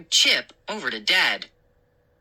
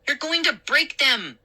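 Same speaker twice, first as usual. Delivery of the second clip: worried warning; 10 semitones higher than usual. A narrator's reading. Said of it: "Mindy warned".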